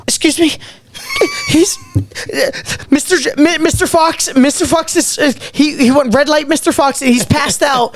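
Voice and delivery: high, panicky voice on the radio; terrified report. Retold or imitated. Nineteen-year-old host radioing that [name] passed out, high and panicky. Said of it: "I'm terrified".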